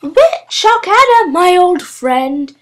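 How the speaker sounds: high, slow gushing witch cry; cried greeting. A narrator's reading. Pongwithy crying out to her old friend at the door, high and slow. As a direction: gush it out like a delighted hostess witch. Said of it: "cried Witch Pongwithy".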